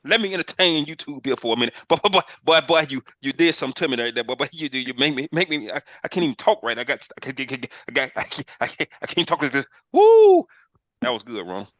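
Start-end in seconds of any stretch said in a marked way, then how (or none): 0.0-6.8 higher and louder, excited babble; playful over-the-top hype, boy boy boy; hyped-up rave. Acted. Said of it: "that's me doing the Elmer Fudd and playing". 6.8-9.7 light breathy cartoon-hunter voice, mostly whispered; a hint of Elmer Fudd, too giddy to talk; lightly voiced Elmer Fudd stammer. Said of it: "that's me doing the Elmer Fudd". 9.9-10.5 very high, loud whoop; joyful whoop. Acted. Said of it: "that's me doing the Elmer Fudd and playing".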